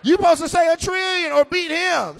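ordinary delivery

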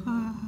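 hushed cooing sigh; quiet mock-adoring "aww"; soft, adoring "ah". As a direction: melt softly at something cute. Doting reaction to cute children.

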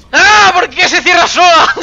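high, loud shriek of protest; frightened scream. Real, not acted. Player frightened by a self-closing door, screaming in fright. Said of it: "es normal que me asuste".

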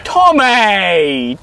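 loud, high-pitched cry of the name; exasperated shout. Acted. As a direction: yell the name in protest. Interrupting accuser in quarrel.

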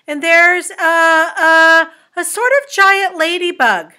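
high, loud astonished captain; crying out. A narrator's reading. Captain at his telescope reporting to officers, crying out. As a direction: call out each bewildering sighting. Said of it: "he cried".

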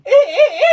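high, loud squealed syllables; invented language. Acted. Friend answering in their secret language, squealed gibberish. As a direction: squeal nonsense as if it were words. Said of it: "speaking our language".

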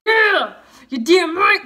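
loud, high gloating shout; hammed-up victory yell; triumphant. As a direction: crow over the win. Triumphant comeback after winning.

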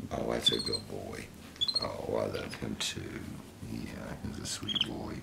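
very soft, low affectionate murmur; tender cooing. Real, not acted. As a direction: soothe a sleepy little pet. Soothing the pet monkey.

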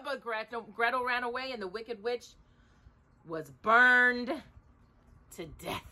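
usual speaking voice

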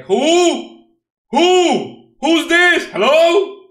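high, loud, mocking yell of disbelief; jokey indignant yelling at the card; mock outraged shout. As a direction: shout it like nobody's ever heard of him. Mocking an unknown player's card.

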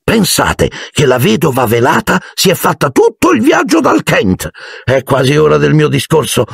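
high-pitched self-important chatter; pompous, pleased ghost voice; proud host. A narrator's reading. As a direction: show off your distinguished guest. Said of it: "disse lui orgoglioso".

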